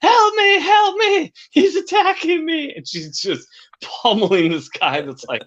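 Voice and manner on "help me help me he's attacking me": very high falsetto mock yell; high-pitched, drawn-out pretend distress; imitated yelling. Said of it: "she's yelling".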